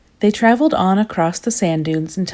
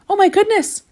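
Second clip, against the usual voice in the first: high, loud panicked shriek; 5 dB louder than usual. A narrator's reading. Mister Worry shrieking in panic, lost inside the pyramid. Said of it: "shrieked Mister Worry".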